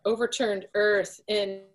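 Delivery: normal talking voice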